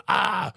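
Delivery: high short cry of pain; mild mock pain cry. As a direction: yelp from a hurt shoulder. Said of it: "I got up in pain going".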